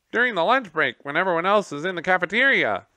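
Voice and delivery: high, loud, fast squeaky voice; a gerbil explaining the janitor's routine, piping. Acted. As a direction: pipe up eagerly. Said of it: "piped up one of the gerbils".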